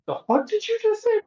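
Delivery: high-pitched imitation of an incredulous woman; mock shocked disbelief. Woman reacts to a man's crude come-on, high mock disbelief. Acting out her shocked reply.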